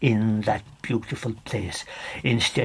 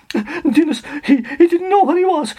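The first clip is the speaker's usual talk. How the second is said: much higher and louder panic; frightened narration; 8 dB louder than usual. A narrator's reading. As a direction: blurt it out in panic. Climax of terror in darkness.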